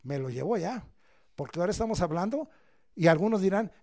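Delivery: normal talking voice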